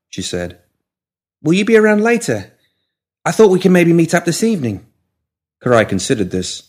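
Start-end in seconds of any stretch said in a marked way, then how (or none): 1.4-4.9 high, louder character voice, friendly invitation; high-voiced, eager invitation; hopeful young Molly. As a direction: pitch it well up, asking to meet. Molly's dialogue inside the narration.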